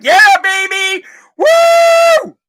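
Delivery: high, loud party whoop; whooping celebration. Acted. Podcaster celebrating an imagined payout, whooping celebration. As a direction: cheer wildly, climbing into a long shrieked woo.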